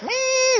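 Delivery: high nasal-free whining saw noise mimic; imitated saw whine. Imitating the saw to the congregation, high whine. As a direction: mimic the table saw's whine. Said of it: "this weird noise".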